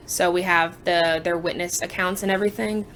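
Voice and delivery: usual speaking voice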